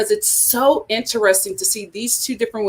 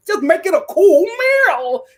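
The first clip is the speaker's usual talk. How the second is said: put-on higher, louder brash New York accent; New York lady; 8 semitones higher, 3 dB louder than usual. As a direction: bark it like a pushy New York clerk. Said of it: "It was so New York".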